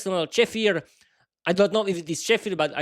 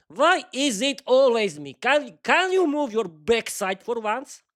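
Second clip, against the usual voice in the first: angry snap; 5 semitones higher, 3 dB louder, slower than usual. Acted. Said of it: "Peter snapped back angrily".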